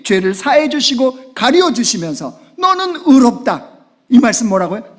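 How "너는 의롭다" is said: slow, high proclamation; slow, lifted pronouncement; proclaimed. Acted. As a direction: declare it slowly as God's voice. Preacher voices God's word.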